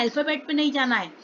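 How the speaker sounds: ordinary delivery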